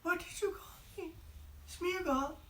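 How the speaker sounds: very high, soft, stunned creature voice; high and wondering, hearing his old name; trembling high Gollum squeak. Acted. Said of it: "And he said".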